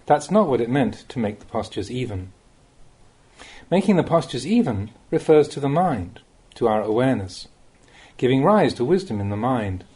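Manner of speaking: usual speaking voice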